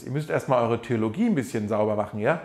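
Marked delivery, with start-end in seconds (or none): none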